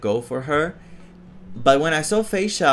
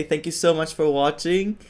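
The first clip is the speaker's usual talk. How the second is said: ordinary talk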